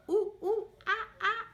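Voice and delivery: high, loud ooh-ooh-ah-ah monkey calls; playful monkey hoots with a laugh; monkey chatter. Pretend. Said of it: "don't forget to chatter".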